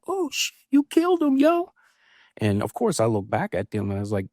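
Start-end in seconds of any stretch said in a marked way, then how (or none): 0.1-1.7 mock onlooker shock. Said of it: "they was like".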